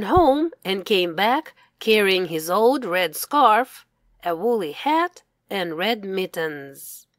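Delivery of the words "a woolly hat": usual speaking voice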